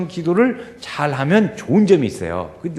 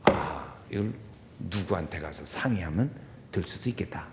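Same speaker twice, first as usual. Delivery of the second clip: quiet inner thought; 9 semitones lower, 10 dB quieter than usual. Retold or imitated. Inner thought during quiet meditation.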